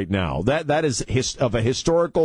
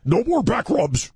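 much higher evil mock threat; 13 semitones higher than usual. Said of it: "here's the evil voice again".

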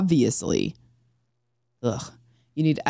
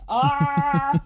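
yawning sigh; 9 semitones higher than usual. Real, not acted. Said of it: "the Doppler effect with your yawn".

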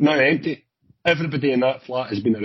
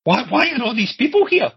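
much higher, incredulous demand; acted disbelief; 11 semitones higher than usual. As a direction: demand an explanation, high-pitched and stammering. Boss confronts cop, retold.

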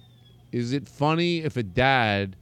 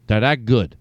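plain; 5 semitones lower than usual. Acted. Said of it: "I gotta run ads".

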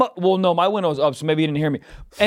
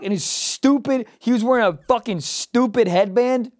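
usual speaking voice

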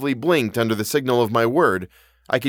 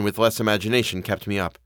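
ordinary delivery

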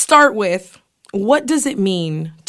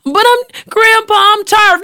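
put-on high, loud whine of a tired kid; whiny kid and grumpy grandpa; 11 semitones higher, 6 dB louder than usual. Cousin joking about being kept in the studio, whiny high kid voice. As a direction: whimper like a worn-out grandchild.

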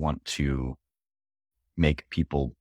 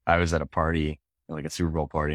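ordinary talk

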